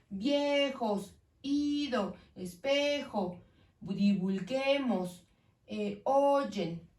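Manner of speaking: "Viejos, ido, espejo, divulguemos, oyen", each stretched on the next-to-last syllable; stress on penultimate syllable. Shown as a demonstration. Teacher lists palabras graves, "Viejos, ido, espejo, divulguemos, oyen" slowly stressed on the penultimate. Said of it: "palabras graves".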